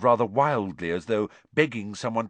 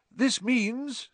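higher, measured classroom address; lively teacher character. Acted. Professor Lupin briefing the class about the boggart, lively teacher. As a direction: explain it vividly to a class.

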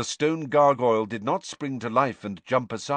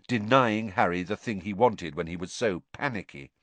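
ordinary delivery

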